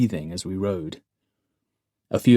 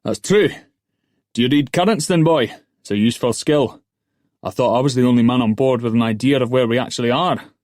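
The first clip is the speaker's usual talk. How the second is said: loud, raised-pitch master's voice; ship's master; 4 semitones higher, 6 dB louder than usual. A narrator's reading. Master questions oarsman's knowledge of currents, loud character voice. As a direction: address the young oarsman loudly from above.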